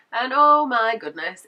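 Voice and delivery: loud, drawn-out exclamation of pain remembered; emphatic exclamation. Real, not acted. Vlogger telling viewers about leg cramp pain, emphatic exclamation. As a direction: stretch it out loudly, stressing how much it hurts.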